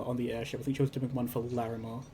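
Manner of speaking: ordinary delivery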